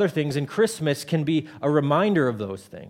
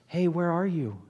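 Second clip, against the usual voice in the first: gentle calling; 3 dB quieter than usual. Shown as a demonstration. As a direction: call out far too gently for a parent. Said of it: "I'm not saying it like this".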